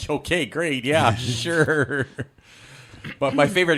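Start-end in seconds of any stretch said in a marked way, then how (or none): 0.1-2.1 Han. Acted. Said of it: "And Han's like".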